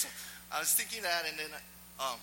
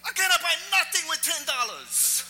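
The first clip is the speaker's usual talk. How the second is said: very loud, high-pitched retort; full-volume comic protesting yell; yelling back; 12 dB louder than usual. Acted. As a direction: shout back indignantly from down the street. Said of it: "And he yells back".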